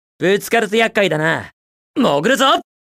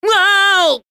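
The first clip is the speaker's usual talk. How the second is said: thrilled wow; 9 semitones higher, much slower than usual. Acted. Hero diving down fast, high thrilled wow.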